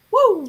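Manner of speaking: high, loud cheer; excited "woo!"; celebratory whoop. Real, not acted. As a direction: whoop for a friend's success. Cheering a writer's progress.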